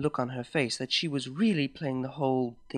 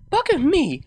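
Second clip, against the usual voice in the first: much higher and louder startled exclamation; shocked gasp; 13 semitones higher, 8 dB louder than usual. Acted. Hungover mare hears about seven scotches, shocked gasp. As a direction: blurt it out in disbelief. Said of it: "I gasped".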